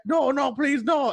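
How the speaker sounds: high-pitched mock begging; hammed-up panicked plea; imitated pleading. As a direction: plead like the fan being shoved out. Drunk fan begs crowd.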